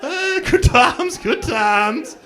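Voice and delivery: slow, high, loud sigh of glee; hammed-up nostalgic gloat; mock wistful. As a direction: savour it like a villain. Wistful villain.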